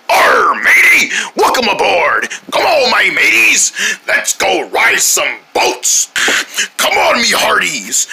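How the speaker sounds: hearty, hammed-up pirate call; rally the crew like a pirate; pirate captain. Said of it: "act like a pir-, pirate".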